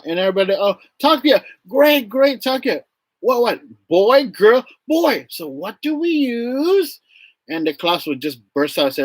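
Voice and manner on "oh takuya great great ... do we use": high, animated classroom voice; enthusiastic teacher. Retold or imitated. Teacher quizzing the class about Takuya, high and enthusiastic. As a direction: praise and prompt the pupils eagerly.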